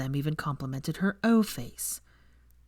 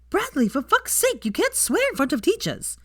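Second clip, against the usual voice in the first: put-on high, prim young girl telling off a classmate; a bossy kid correcting Bradley; scolding schoolgirl; 13 semitones higher, 4 dB louder than usual. Girl scolding Bradley for insulting the teacher, high and prim.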